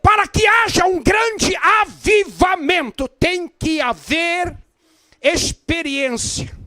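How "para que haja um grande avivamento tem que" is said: loud, rousing sermon delivery; preaching at full force. Acted. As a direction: fire up the congregation. Preacher exhorts, rising to shouts.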